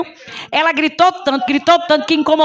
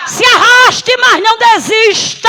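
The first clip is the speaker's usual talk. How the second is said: urging shout; 7 semitones higher, 4 dB louder than usual. Acted. Preacher voices urging to keep going.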